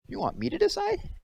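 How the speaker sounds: put-on airhead girl. A narrator's reading. Dim-witted Myra asked to choose.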